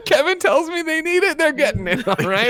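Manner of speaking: manic laughing boast. Acted. Telling the cohosts Kevin's orders, manic laughing crow. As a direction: crow it like a mad medic.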